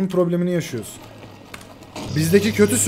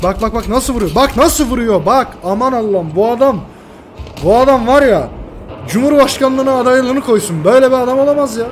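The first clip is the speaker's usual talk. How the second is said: loud, higher, building awe at the pilot; amazed raving; 6 semitones higher, 5 dB louder than usual. Real, not acted. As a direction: rave in disbelief about him. (hayranlıkla yükselen, yüksek sesli coşku)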